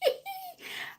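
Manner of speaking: shrill laugh breaking into a squeal; high squealing laugh. Real, not acted. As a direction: crack up at herself. Laughs at her own rant.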